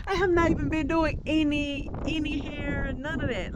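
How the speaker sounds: high, laughing and fading soft; laughing disbelief. Real, not acted. Telling the agent she did nothing, laughing.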